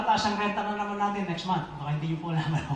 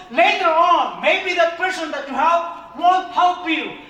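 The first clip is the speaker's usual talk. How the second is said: louder, higher sermon, slow and emphatic; preaching at full force; 7 semitones higher, 6 dB louder, slower than usual. Acted. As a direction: drive the point home to the congregation.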